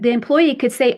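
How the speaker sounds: normal talking voice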